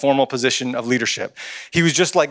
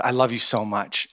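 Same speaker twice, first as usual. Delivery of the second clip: lower, softer affection; tender, no accent; 5 semitones lower, 6 dB quieter than usual. Retold or imitated. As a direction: drop the yell and say it gently. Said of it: "I didn't do it with the accent".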